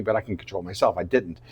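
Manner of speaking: ordinary talk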